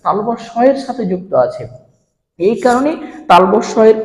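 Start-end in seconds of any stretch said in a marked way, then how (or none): none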